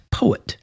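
poet, softly, PO stressed and et trailing off; falling away. Shown as a demonstration. Teacher showing de-emphasis, poet with et falling away. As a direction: let the et of poet fall away like an afterthought. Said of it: "a falling away of the et".

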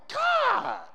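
high, loud anguished plea; retold cry to God. Preacher retelling his cry to God, high and loud. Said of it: "I'm like".

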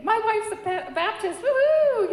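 husband celebrating his wife's news, whooping; cheering. Retold or imitated.

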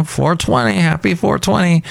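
high, put-on holiday greeting; mock cheer. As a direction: raise the pitch for a token celebration.

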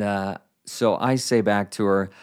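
ordinary delivery

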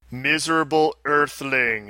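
usual speaking voice

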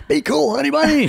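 high, loud yelled line; shouted quote. Acted. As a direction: bark it out at full volume. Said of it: "Or you could go".

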